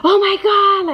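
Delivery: high, hammed-up exclamation; mock yelling. Shown as a demonstration. Mom showing what her kids weren't doing, mock yelling. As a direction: demonstrate an over-the-top kid's yell. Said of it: "It wasn't, like, yelling, like".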